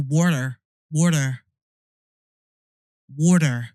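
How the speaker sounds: American pronunciation practice; American accent. Shown as a demonstration. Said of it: "best American accent".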